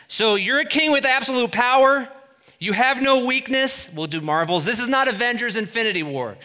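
loud, high, driving sermon build; preaching at full force. Acted. Preacher driving the point home to the church, loud and high. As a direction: hammer it home to the congregation.

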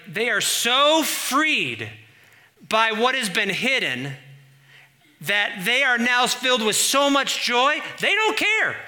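swelling revival preaching, slow to a high peak; full-force preaching. Acted. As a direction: preach it to the rafters, building to a joyful cry. Builds to joyful peak.

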